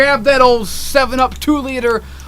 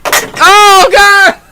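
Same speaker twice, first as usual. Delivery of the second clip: high, loud wail; hammed-up cry to God; overwhelmed outburst; 11 semitones higher, 8 dB louder than usual. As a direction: burst out mid-thought, loud and high. Overwhelmed outburst mid-sentence.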